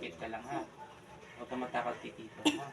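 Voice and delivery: very soft, low soothing voice; gentle reassurance. Real, not acted. Calming a scared child. (mahina at malumanay na pang-aalo)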